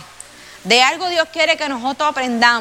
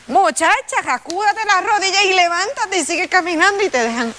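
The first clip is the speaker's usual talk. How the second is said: higher, brisk no-nonsense scold; mock scolding; 7 semitones higher than usual. Telling a fallen woman to get up, higher no-nonsense scold. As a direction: shake her up and tell her to get moving. Scolding someone who fell.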